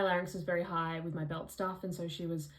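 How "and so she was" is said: normal talking voice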